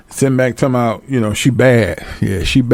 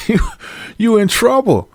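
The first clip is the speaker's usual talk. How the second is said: ordinary talk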